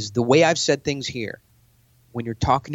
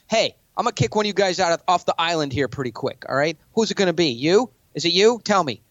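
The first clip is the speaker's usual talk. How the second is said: higher and pushy, jabbing questions; aggressive challenge; 5 semitones higher than usual. Shown as a demonstration. To a billionaire in a pitch meeting, a pushy challenge. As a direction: corner them and demand an answer. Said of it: "go to billionaire".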